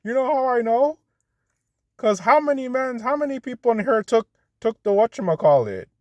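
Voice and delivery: very high-pitched mimicking voice; mocking imitation. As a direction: mimic him in a silly, squeaky-high voice. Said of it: "That's how he talks".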